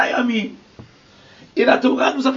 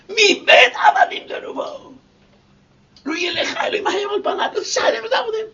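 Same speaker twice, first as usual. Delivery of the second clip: tearful ecstatic cry; 8 semitones higher than usual. Acted. Relives hearing the divine voice.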